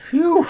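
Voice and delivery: high, loud sigh of relief; relieved phew. Acted. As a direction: blow out the fear. Relief safe in bed.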